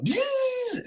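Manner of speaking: high, steady buzzing whine; imitated reel drag. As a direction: buzz like line zipping off a reel.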